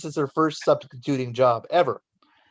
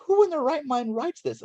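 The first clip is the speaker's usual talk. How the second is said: higher-pitched mock outrage, lightly laughing; amused nurse; 12 semitones higher than usual. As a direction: play the nurse baffled by the note. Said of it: "she says".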